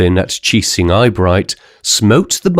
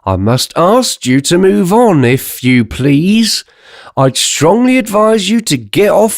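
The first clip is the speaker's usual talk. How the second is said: inspector; 9 semitones higher than usual. Acted. Inspector ordering crowd away.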